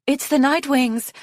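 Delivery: high alarmed shout from the walls; scream. A narrator's reading. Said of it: "screamed a voice".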